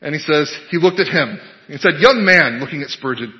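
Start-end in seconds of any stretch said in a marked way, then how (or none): 2.0-2.6 loud, higher pulpit call; sudden mock-stern preacher's hail; preacher singling someone out. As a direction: call out one listener from the pulpit. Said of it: "looking around the congregation for someone to pick on".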